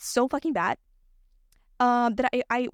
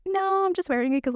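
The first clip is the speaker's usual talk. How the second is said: retold denial; 8 semitones higher than usual. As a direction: lift the voice into a light, defensive denial. Retells her sheepish denial to professor.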